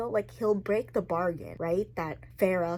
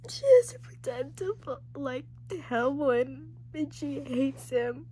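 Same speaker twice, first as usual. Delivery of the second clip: tearful; 8 semitones higher than usual. Real, not acted. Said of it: "I'm literally crying".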